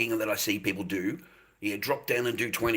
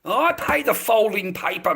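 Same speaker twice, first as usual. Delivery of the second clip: loud rant; 9 semitones higher, 9 dB louder than usual. Real, not acted. Loud ranting start.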